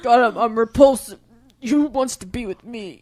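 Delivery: mock moping loud; whiny vampire. Mocking the brooding vampire's self-pity, hammed-up and loud.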